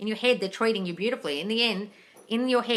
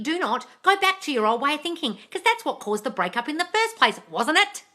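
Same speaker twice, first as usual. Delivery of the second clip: normal talking voice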